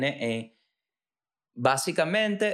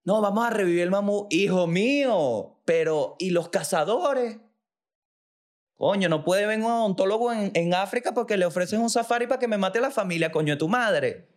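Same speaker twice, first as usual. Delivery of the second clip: much higher, strange voice of an indignant elephant; talking elephant; 7 semitones higher than usual. Acted. Elephant complaining to the scientists, high and indignant. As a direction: play the fed-up elephant, pitched way up.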